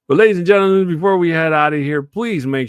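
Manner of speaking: big booming host announcement; announcer voice. Real, not acted. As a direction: call it out like an MC. Sign-off announcement to viewers.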